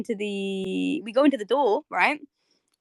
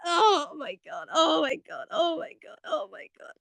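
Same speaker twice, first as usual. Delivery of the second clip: overwhelmed gushing; 6 semitones higher, 4 dB quieter than usual. Real, not acted. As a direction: repeat it helplessly, running out of steam. Overwhelmed, repeating it as it fades.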